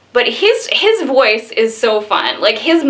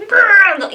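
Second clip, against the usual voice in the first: a higher, louder playful grr; mock growl; 10 semitones higher, 4 dB louder than usual. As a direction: growl like a grumpy host. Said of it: "saying all these mean things and yelling".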